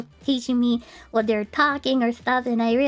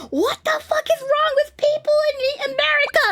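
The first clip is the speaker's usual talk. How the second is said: high, fast, scandalized outburst; mock outrage; 12 semitones higher, faster than usual. Streamer retelling her shock at people in America, mock-scandalized. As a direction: blurt it out in hammed-up disbelief.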